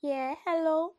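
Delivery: high, loud silly greeting; hammed-up high hello; put-on phone voice. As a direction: answer a friend in a high put-on voice. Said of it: "Or I'll be like".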